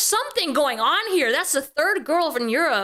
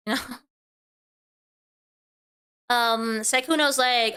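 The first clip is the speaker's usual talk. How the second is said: ordinary talk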